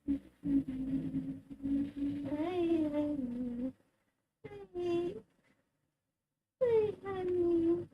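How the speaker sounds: high, slow, soft coo; doting owner to her cat. Real, not acted. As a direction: coo it to the pet. Cooing to cat.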